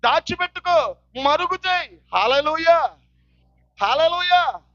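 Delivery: high, driving warning from the pulpit; full-force preaching. Real, not acted. Preacher warning those who cover their sins, full-force preaching. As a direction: build a list of warnings, each phrase falling at its end.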